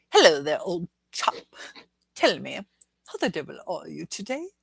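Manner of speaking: second go at mock British greeting; British accent. Shown as a demonstration. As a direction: play a British old chap, deliberately. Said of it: "did I do that all right".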